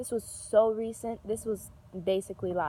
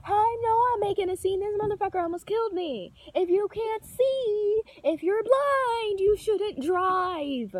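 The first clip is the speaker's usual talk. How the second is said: heated rant; 10 semitones higher than usual. Retold or imitated. Still yelling at the old driver after the near miss. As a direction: lay into the driver, dragging out the last words. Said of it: "I'm like".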